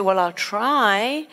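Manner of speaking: slow, drawn-out reluctant try; tentative doctor. Retold or imitated. Doctor offering a patient little hope, tentative. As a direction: stretch it out without conviction. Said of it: "Very different to".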